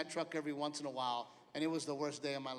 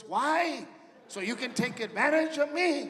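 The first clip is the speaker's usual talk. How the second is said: suspicious dad; 7 semitones higher, 7 dB louder, slower than usual. Retold or imitated. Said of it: "his second response is".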